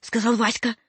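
usual speaking voice

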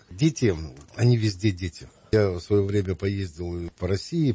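usual speaking voice

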